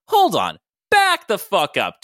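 loud, high, slow stop-right-there; loud interruption. Acted. Todd leaning out the window stopping the fight, loud slow demand.

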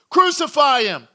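loud, high shout of a crowd demand. Acted. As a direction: call it out like an angry mob. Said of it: "they shouted all the louder".

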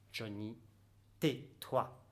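hushed. Acted. Inner voice hushing him.

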